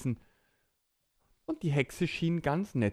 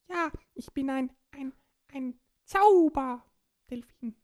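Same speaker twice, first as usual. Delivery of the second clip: raised cartoon dolphin voice; 13 semitones higher, 4 dB louder than usual. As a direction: play a friendly dolphin. Dolphin character.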